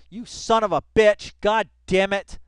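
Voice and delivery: yelling curses at the screen; furious outburst. Real, not acted. Streamer furious at his browser, yelling curses. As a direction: explode at the computer. Raging at a misclick.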